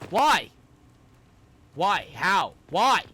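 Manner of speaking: loud, high, repeated why at the screen; exasperated yelling. Real, not acted. Gamer shouting at the game's level design in disbelief. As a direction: throw each why at the game.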